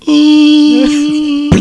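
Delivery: long, high, loud held "ooh"; falling snake wail. Pretend. Host imagining the falling snake, drawn-out wail. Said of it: "the snake all the way down was like".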